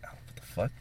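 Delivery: breathless whispered what the fuck; whispered shock. Retold or imitated. Retelling the moment he found the keychain gone, whispered. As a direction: hush it out like a stunned thought.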